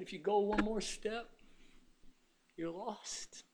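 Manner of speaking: hushed, grave warning; God's warning. Acted. God warns someone at the cliff edge, hushed. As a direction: drop to a soft, measured caution. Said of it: "He said".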